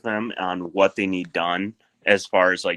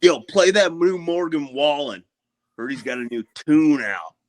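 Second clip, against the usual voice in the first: higher mock bro voice, lightly voiced; crew's motto; 6 semitones higher, 3 dB louder than usual. Storyteller quotes crew's [name] motto, lightly voiced bro. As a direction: toss it off like a job-site bro.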